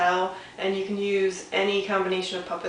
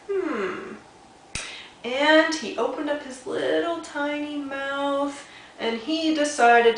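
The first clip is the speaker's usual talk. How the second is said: high, slow, drawn-out build-up; suspenseful thinking; 7 semitones higher, much slower than usual. A narrator's reading. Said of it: "he began to think".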